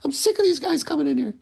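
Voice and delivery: very high, fast squeaky grumble; high squeaky grouch voice; Hammer's grumpy voice. Retold or imitated. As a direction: complain like a cranky old barman, quick. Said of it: "was just like".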